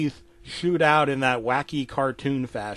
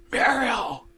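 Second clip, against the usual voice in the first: high yelped name; Courage overjoyed; 9 semitones higher than usual. Acted. Dog seeing his dead owner in the mirror, overjoyed. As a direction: call out in a high, startled voice.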